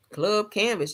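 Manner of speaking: normal talking voice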